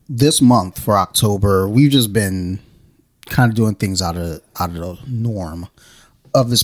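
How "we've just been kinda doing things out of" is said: ordinary delivery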